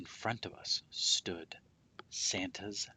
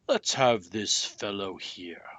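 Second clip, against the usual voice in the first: a lightly voiced Santa, slow and a bit louder; slow, louder jolly Santa line; Santa's voice; 7 dB louder, slower than usual. A narrator's reading. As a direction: Santa picking out the boy, slow and pleased. Santa speaks to the narrator.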